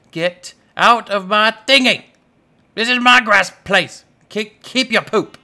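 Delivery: loud, high playful scolding; mock-angry yelling. Player shooing chicken out of thingy, mock-angry yelling. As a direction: yell at a pest in mock outrage.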